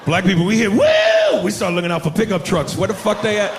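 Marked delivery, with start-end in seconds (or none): 0.7-1.4 a huge, very high, loud whooping yell; piercing party whoop; drunken woo. Retold or imitated. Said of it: "bad history behind that noise".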